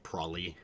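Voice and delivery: "probably" contracted to PRAH-lee; old-time Utah pronunciation. Shown as a demonstration. Streamer passing on mom's example, "probably" as PRAH-lee. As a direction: drop both b sounds from "probably". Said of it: "that also included things like".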